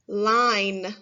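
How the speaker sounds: said on its own, slow and clear; clear test word. Shown as a demonstration. Teacher saying a test word for children to listen to, clearly. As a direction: present the word for careful listening. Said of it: "You have to do your best to listen".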